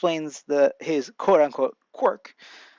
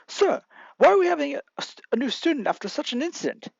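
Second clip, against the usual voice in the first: high-pitched mock objection; put-on high voice, querying the teacher; protesting student; 10 semitones higher than usual. A narrator's reading. Student questions teacher about new classmate, high-pitched protest.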